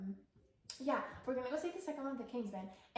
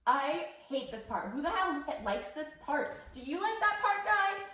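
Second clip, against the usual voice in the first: put-on loud, slow, rising drunken rant; drunk heckler; 5 semitones higher, 6 dB louder than usual. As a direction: heckle the film, louder with each line. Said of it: "And was like".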